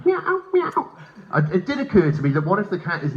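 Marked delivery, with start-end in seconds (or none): 0.0-0.9 very high cat meow. Acted. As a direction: imitate a cat in a high squeaky voice. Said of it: "and the cat's going".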